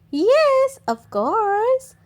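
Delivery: a hint of a kindly grandmother; lightly voiced granny. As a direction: gently agree as granny. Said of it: "replied Granny Pig".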